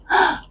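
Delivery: short, high, soft gasp; startled gasp. Retold or imitated. Woman hearing God laugh at her words, startled gasp. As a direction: re-enact a small intake of fright. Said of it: "Y me asusté".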